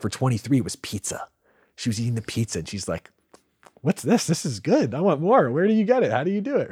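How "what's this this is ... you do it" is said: high, loud and eager; excited character voice. Retold or imitated. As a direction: gush like a kid tasting pizza. Voicing the character 22 eating pizza.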